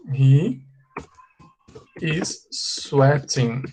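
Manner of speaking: IS SWEATING very slow, syllables apart; slow model pronunciation. Shown as a demonstration. Teacher points out that he is sweating, very slowly. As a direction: stretch out "is sweating" for learners. Teacher repeats new word for students.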